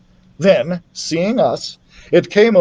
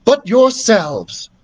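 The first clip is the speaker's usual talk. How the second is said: put-on solemn young voice; 7 semitones higher, slower than usual. A narrator's reading. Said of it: "I added".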